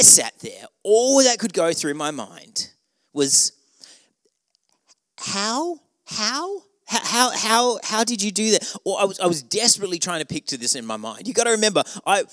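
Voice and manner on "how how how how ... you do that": high, climbing disbelief on every how; baffled inner voice that can't believe it; higher and higher hows of mock disbelief; incredulous how. Incredulous thought about her crash.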